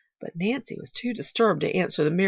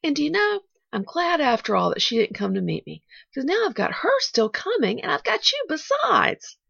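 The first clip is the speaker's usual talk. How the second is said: put-on higher, cheerful little girl; bright; 8 semitones higher, 4 dB louder than usual. A narrator's reading. Pollyanna happy to have both aunt and Nancy, bright. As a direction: lift it brightly, slowing at the end. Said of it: "she went on brightly".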